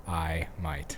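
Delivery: "eye might" with full stops between words; halting Australian attempt, words apart; choppy, separated words. Shown as a demonstration. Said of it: "You're putting periods".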